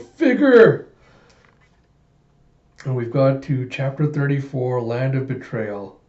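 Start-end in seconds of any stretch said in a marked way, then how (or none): 0.0-0.9 high, loud anguished cry of a name; retold horrified yell. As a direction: scream after a falling friend. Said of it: "yells out".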